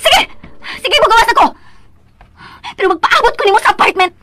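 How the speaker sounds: loud, bitter parting shots; husband leaving after the fight, still furious; angry. Acted. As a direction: storm off still fuming.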